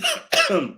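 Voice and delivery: loud hacking coughs; coughing fit. Real, not acted. Commentator choking mid-sentence, loud real coughs. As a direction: cough hard, can't get words out.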